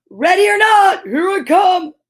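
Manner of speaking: loud high wolf shout; growled shout. Acted. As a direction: burst in yelling as the wolf. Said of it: "the wolf growled".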